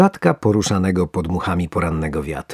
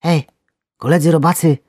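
high, louder squeaky worm call; 9 semitones higher, 4 dB louder than usual. Acted. Worm squeaks urgently to wake his friends. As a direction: squeak to rouse the others. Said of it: "pisnął jeden".

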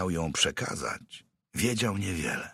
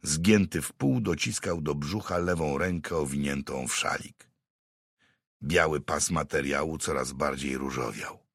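ordinary delivery, nothing marked

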